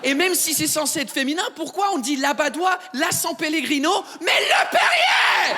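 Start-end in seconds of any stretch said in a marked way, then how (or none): 4.2-5.5 high, loud, slowed exclamation; outraged shout. Acted. Ranting about French gender rules, high outraged cry. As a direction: yell "le Perrier" in high outrage.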